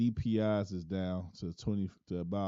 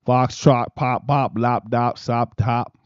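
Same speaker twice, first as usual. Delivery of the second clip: louder and higher test syllables; mic test; 4 semitones higher, 17 dB louder, slower than usual. Shown as a demonstration. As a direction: punch each nonsense word into the mic. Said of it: "mic test syllables".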